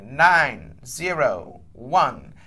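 very slow, low, digit-by-digit dictation; measured, deliberate number readout; saying it slowly. Real, not acted. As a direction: space out each number for someone writing it down. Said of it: "I'm gonna say it slowly".

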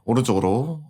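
ordinary delivery

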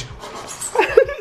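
breathy laugh. Real, not acted. Mocking friend's slip.

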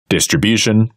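normal talking voice

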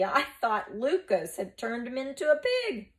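high, loud mocking jeer; scornful. A narrator's reading. Plubius mocks Antonius's theory, scornfully. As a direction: scoff at the idea loudly. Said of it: "Plubius laughed scornfully".

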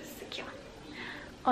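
slow unvoiced whisper; ASMR whisper. Shown as a demonstration. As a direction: breathe it close to the mic. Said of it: "eu tô viciada em A S M R".

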